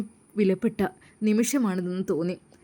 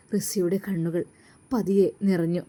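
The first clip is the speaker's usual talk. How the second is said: plain reading voice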